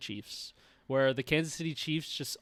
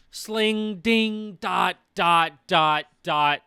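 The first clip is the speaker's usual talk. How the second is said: playful sound effects; 3 semitones higher, 5 dB louder, much slower than usual. Pretend. Imitating throw sounds.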